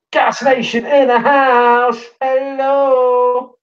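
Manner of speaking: slow, loud hype shout-out; hype announcer. Pretend. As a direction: announce them like a hype man. Greeting a viewer, announcer style.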